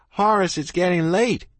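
high-pitched holler to someone out of sight; calling a friend in from afar. A narrator's reading. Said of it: "I hollered".

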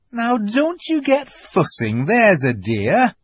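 high, slow, lilting reassurance; Mrs Beaver, motherly chiding. A narrator's reading. Missus Beaver tells Susan not to fuss, motherly. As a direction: draw it out loudly, fussing over a child.

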